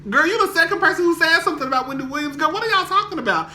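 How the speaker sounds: high, fast rush of confusion; what are y'all talking about, rushed and high; baffled exclaim. Retold or imitated. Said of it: "And I replied".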